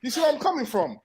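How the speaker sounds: high, worked-up outburst; heated rant. Real, not acted. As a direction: pitch climbing as he gets wound up. Heated rant about Undertaker comment.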